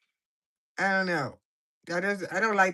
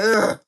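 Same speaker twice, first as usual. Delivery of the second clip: loud, drawn-out groan of disgust; a loud mock ugh of disgust; 8 dB louder than usual. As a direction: groan it like you can't stand this. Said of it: "She look like".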